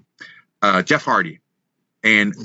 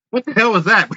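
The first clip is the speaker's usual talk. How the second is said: higher, louder mock yelp of surprise; startled yell; 9 semitones higher, 3 dB louder than usual. A fan struck by the thrown tape, mock startled yell. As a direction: imitate a guy just hit on the head.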